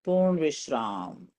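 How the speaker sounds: ordinary talk, nothing marked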